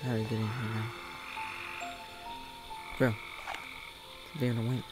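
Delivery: ordinary delivery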